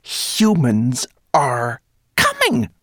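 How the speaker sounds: slow, loud, high proclamation; shrill squeak. A narrator's reading. Rat warning the whole cave, slow shrill squeak. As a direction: announce it word by word, squeaking up. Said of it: "she squeaked so shrilly".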